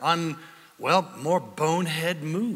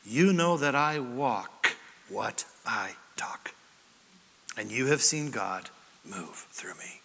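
whisper; 7 semitones lower, 6 dB quieter than usual. Acted. Paul's quote, dropped to whisper.

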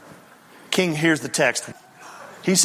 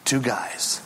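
usual speaking voice

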